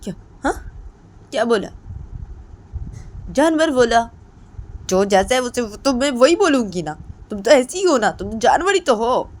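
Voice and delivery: angry retort. Acted. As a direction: snap back hurt and furious. Kids' quarrel acted out.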